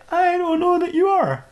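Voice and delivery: high, slow, drawn-out doubt; sceptical. Acted. Dexter disputes the Doctor's boast.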